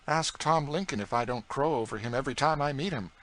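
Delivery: put-on a cheeky boy egging the cook on; young George. A narrator's reading. George teasing Aunt Chloe about other cooks, boyish voice.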